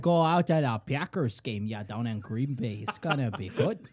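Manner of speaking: broad mock Wisconsin accent. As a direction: play a Wisconsin football fan. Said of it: "it's interchangeable with Wisconsin".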